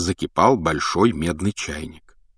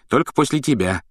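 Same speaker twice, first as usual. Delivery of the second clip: с улыбкой; 6 semitones higher than usual. Acted. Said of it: "улыбнулся Рон".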